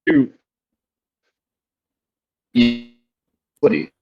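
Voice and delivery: usual speaking voice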